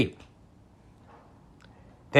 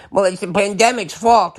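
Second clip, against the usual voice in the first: higher whiny mock excuse; mock supporter excuse; 6 semitones higher than usual. Mocking a supporter blaming the pandemic, whiny. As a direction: whine out the excuse. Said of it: "mock supporter excuse".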